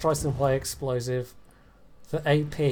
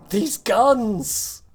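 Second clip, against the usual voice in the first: exasperated exclaim, stress on guns; 9 semitones higher, 6 dB louder than usual. Real, not acted. Complains again.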